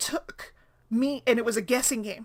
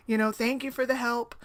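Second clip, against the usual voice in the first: ordinary talk, nothing marked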